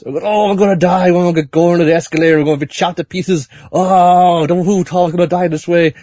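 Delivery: high-pitched hammed-up wailing panic; wigging out. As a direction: moan in exaggerated dread of dying. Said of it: "he's still wigging out".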